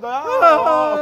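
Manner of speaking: berrido fingido, agudo y fuerte; llanto imitado. Retold or imitated. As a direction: suelta un llanto exagerado de queja. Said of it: "Ya no hay que estar llorando". (llanto fuerte de parodia)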